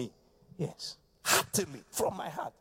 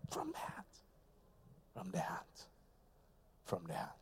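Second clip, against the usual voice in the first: much softer, lower, almost hushed; soft refrain; 10 semitones lower, 18 dB quieter than usual. Real, not acted. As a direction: drop it to a hush. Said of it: "sudden soft refrain".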